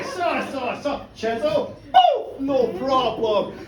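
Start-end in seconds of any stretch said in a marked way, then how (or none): 1.9-2.5 sudden high shouted boom; big comic sound effect; loud boom. Retold or imitated. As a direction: slam it out like a hammer blow. Sawing through second leg.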